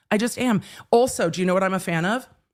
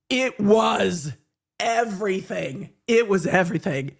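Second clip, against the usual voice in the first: gushing awe. Real, not acted. Gushing about Serena outfit.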